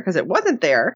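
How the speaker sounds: normal talking voice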